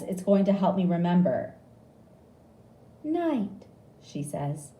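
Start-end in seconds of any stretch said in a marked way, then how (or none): 3.0-3.7 high prompt; nurse voice. A narrator's reading. Nurse giving a word in a game, high.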